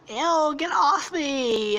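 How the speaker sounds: put-on high, drawn-out girly disgust; high-pitched mock revulsion; disgusted Daphne. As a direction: whine it slowly, pushing someone away. Daphne rejects Velma, acted.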